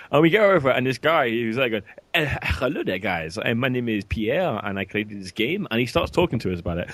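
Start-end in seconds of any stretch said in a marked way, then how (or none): 2.1-5.7 hesitant, hammed-up French accent; thick mock French accent. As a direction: imitate a very French developer introducing himself. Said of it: "He was the Frenchest French guy".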